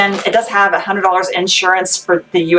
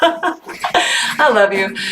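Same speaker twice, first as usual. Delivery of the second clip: still laughing; 2 semitones higher, much slower than usual. Real, not acted. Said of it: "I snorted".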